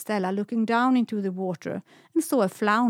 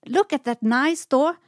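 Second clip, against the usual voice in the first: loud high excited shout; 6 semitones higher, 6 dB louder than usual. A narrator's reading. Semlan shouts about the door she has spotted, loud excited. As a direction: call out in delight. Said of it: "shouted Semlan".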